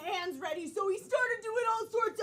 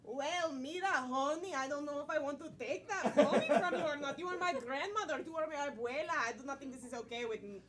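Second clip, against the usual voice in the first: put-on Spanish accent; 7 semitones lower, 4 dB quieter than usual.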